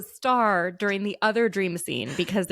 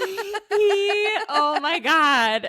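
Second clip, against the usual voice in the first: words squeezed out between high, loud laughs, slowed; barely getting words out for laughing; laughing through words; 10 semitones higher, 4 dB louder, much slower than usual. Real, not acted. Still cracking up about dad, laughing through words. Laughing while trying to talk.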